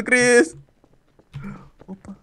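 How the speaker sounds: ordinary talk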